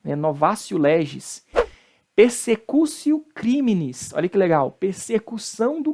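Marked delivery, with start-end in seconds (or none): 0.2-1.4 novatio as "novásio"; Latin T as C. Shown as a demonstration. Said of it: "o T de novo aí com som de C". 2.1-4.1 slow persecutio, "persecúsio"; Latin T as C. Shown as a demonstration. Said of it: "o T com som de C".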